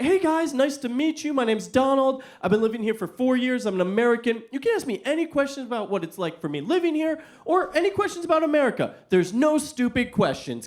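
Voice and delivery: high, bright, eager greeting; happy energy. Retold or imitated. American guest greeting the high school class with happy energy. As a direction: bounce in like an upbeat new guest. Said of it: "happy energy".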